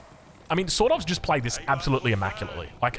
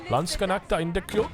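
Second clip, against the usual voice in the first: put-on German accent, rising at the end; 4 semitones higher than usual. Shown as a demonstration. Caster jokingly repeats a game term in a German accent. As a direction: say it like a German production guy. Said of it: "say that in my German accent".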